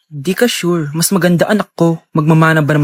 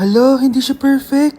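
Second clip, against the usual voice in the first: surprised gasp; 7 semitones higher than usual. Acted. Student surprised at classmate's score.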